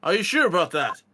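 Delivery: lightly voiced wrestler catchphrase; a hint of a celebrity impression, punched out; John Cena. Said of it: "That was my best John Cena voice".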